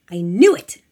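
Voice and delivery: very loud, rising cry; triumphant shout. A narrator's reading. Tiffany in the car spotting the SUV, shouting. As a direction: burst out with it, very loud. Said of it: "Tiffany shouted".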